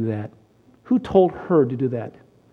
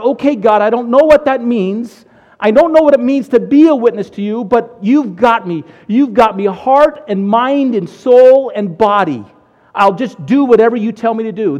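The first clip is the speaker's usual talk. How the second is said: loud, higher-pitched declaration to God; fervent surrender; 6 semitones higher, 8 dB louder than usual. Retold or imitated. New convert giving himself to God, loud and fervent. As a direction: hand yourself over wholeheartedly, voice raised, slowing into emphasis.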